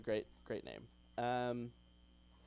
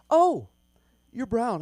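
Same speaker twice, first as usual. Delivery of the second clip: much higher and louder, startled; shocked gasp; 9 semitones higher, 15 dB louder, slower than usual. Retold or imitated. Interviewers seeing him walk in, shocked and high. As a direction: jolt up in surprise at the sight. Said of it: "they're like".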